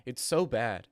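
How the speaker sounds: ordinary talk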